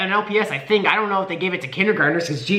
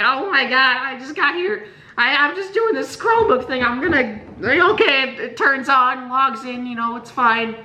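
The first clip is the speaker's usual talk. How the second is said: flustered high voice; 7 semitones higher than usual. Retold or imitated. Flustered self-quote under stares.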